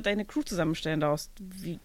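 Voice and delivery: ordinary talk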